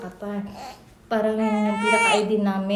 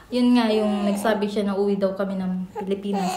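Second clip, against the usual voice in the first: ordinary talk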